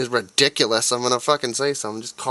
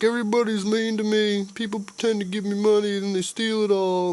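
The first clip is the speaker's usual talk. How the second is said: much higher whiny mock voice; mocking homeless man; 11 semitones higher than usual. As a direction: whimper it like a pitiful victim, hammed-up. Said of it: "They're like".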